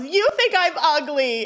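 loud, high, drawn-out wail of a question; yelling. Acted. Girl to teacher before running away, yelling. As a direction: yell it as a hurt accusation, stretching the words. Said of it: "She yells".

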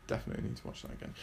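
fast whispered mutter; muttered aside. Real, not acted. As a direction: mutter it quickly under your breath.

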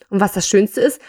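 ordinary delivery, nothing marked

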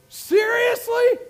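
Seriously stretched slow and shouted high; slow, rising shout on Seriously; loud, drawn-out Seriously. Acted. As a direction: lean into Seriously with a preacher's shout. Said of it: "But seriously?".

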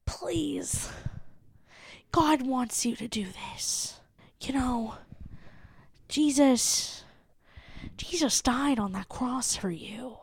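hushed, pleading preacher whisper; impassioned pastor. Retold or imitated. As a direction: beg them softly like a pastor at the altar. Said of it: "impassioned voice when they're like".

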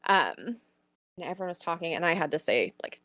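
ordinary delivery

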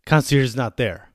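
usual speaking voice

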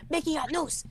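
high, squeaky cartoon-mouse voice; Mickey Got News impression. Host mocking his old rival's channel, high mousy imitation. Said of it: "he sounded like this".

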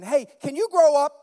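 put-on high, loud snap at the kids; exasperated parent. Pastor retelling scolding his children, loud exasperated snap. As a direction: bark it out, fed up. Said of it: "I found myself saying".

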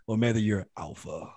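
low, hushed finish on the word ALPHA. Shown as a demonstration. Host naming the generations, ALPHA dropped low and soft. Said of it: "that's how you gotta say it".

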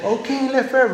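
high-pitched, put-on reply; imagined Daniel replying. Preacher playfully pictures Daniel answering the king, high put-on voice. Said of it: "maybe he hesitated a few seconds".

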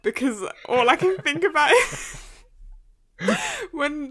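high, loud giggling words; laughing. Real, not acted. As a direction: laugh while trying to talk. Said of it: "Why are you dying?".

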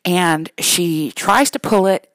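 usual speaking voice

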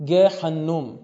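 گه حنوم said loud with a hard G, Ge Hinnom; original Hebrew name. Shown as a demonstration. Lecturer shows students the original name, loud careful pronunciation. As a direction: stress the old Hebrew sound of گه حنوم, hard G, doubled n. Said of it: "چون گه وجود نداشت در زبان عربی".

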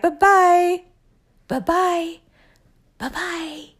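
high singsong bye-bye, fading away; mock dismissive goodbye. Drunk partner under the covers dismissing her, mock singsong.

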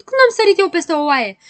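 loud, high, outraged question; indignant shout. Acted. Știe Tot challenges the poem about him, shouting. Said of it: "strigă Știe Tot".

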